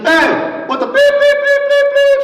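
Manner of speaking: high, loud bleeped-out cussing; mock the angry truck owner's outburst; furious yell. Angry neighbor cusses out the speaker over his parking spot, a loud furious yell. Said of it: "But he's like this".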